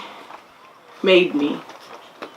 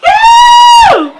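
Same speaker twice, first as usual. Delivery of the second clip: high, loud whoop of joy; leaping up and down, yelling yeah; excited cheer; 7 dB louder than usual. Retold or imitated. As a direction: shout it out like a kid at camp. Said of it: "this girl from Maple Ridge being like".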